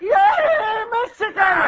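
high, loud shouted cheer; full cheer. Real, not acted. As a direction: yell it out like a real fan. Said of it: "Again, louder".

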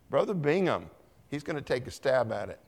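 ordinary talk, nothing marked

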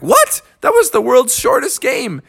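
high-pitched outraged disbelief; incredulous protest. Acted. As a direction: squeak the first word in shock. Jungle cat objects to ending.

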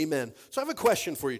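normal talking voice

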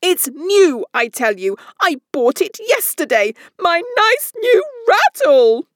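climbing from an angry cry to a very high scream; rising to a scream. A narrator's reading. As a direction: build until the voice breaks into a scream. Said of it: "his voice rose to a perfect scream".

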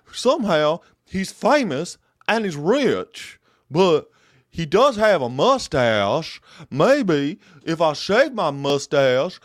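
slow, high, loud Texan drawl; lingering mock Texan drawl. Vlogger mocking the TV host in a lingering Texan drawl. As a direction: keep the drawl going while joking. Keeps the Texan voice while joking.

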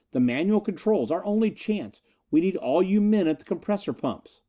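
rally the men, pitch climbing; high-pitched urgent instructions; urgent rallying order. A narrator's reading. Snap urges the panicking men.

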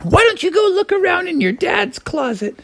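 very high falsetto mother; helpful cartoon mom in falsetto. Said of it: "his mom was like".

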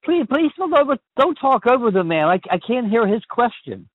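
high-pitched exasperated plea; frustrated, starting high and easing down; real frustration. Real, not acted. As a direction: plead to be allowed to hear, voice pushed up. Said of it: "really frustrated like that".

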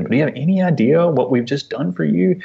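high, incredulous parental appeal; retold frustration, rising at the end; exasperated dad. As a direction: plead the question, pitch lifted on the key words. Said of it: "it was hard for me as a dad to be like".

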